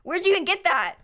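high-pitched, exasperated disbelief; mock incredulous. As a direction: demand an explanation, pitch jumping up. Mock disbelief at mispronounced name.

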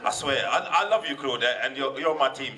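ordinary delivery

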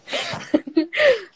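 high burst of laughter. Real, not acted. Loud laugh.

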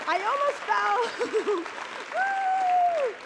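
loud, high whoop of joy; excited scream. Real, not acted. Winning a million dollars, screaming with joy. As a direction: scream with pure delight. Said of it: "You need to have a career in screaming".

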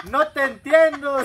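high, loud and slow echo reply; the echo answering. Retold or imitated. The echo replying to the gangoso, slow loud call. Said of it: "le contesta el eco".

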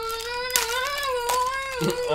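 shrieking laugh, high and breathy; laughing fit; high squealing laugh. Real, not acted. Laughing at a mouth full of gum.

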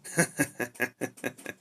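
short run of even chuckles; amused little laugh; light chuckle. Acted. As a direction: chuckle at your own joke. Laughs after joking about his ears.

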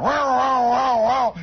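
high, loud wavering wow like a revving engine; mock engine roar; engine torque noise. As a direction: imitate the radial engines winding up. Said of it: "you could feel that torque going".

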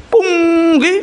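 fighting back tears mid-story; choked with tears. Real, not acted. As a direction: voice breaking into a sob. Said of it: "voice breaks into crying".

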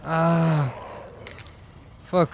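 frantic urgent panic; being chased. Real, not acted. Chased by dogs, stressed. (panique de poursuite)

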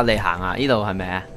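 usual speaking voice

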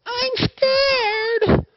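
put-on very high, loud frightened cry of a little girl; young girl scared. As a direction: cry it out like a terrified child. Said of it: "the young girl going".

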